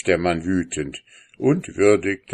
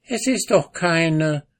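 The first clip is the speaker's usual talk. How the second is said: deutlich höhere Frauenstimme; beharrliche Ehefrau; 10 semitones higher than usual. A narrator's reading. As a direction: stur wiederholen. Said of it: "wiederholte die Frau". (Frau widerspricht dem Mann ohne aufzusehen)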